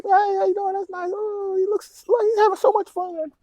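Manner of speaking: mock cheerful passerby. Drivers passing trucker, high cheerful mock voice.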